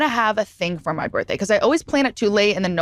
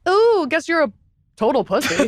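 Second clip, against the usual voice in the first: mocking tease; 6 semitones higher than usual. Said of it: "and we're like".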